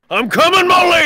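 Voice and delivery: high rescuer's shout; heroic call. Acted.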